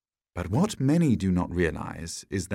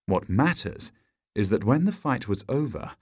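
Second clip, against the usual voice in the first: ordinary delivery, nothing marked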